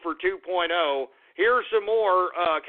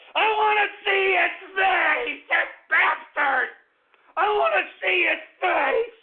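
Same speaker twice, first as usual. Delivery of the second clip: high, loud, slow raging yell; furious shout; 11 semitones higher, 3 dB louder, slower than usual. Acted. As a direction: bellow it, losing control. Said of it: "Let me calm down".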